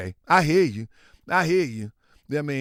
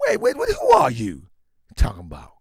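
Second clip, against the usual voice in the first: mock surprise; 10 semitones lower than usual. Mock confused reaction.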